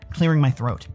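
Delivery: ordinary delivery, nothing marked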